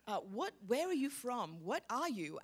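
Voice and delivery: normal talking voice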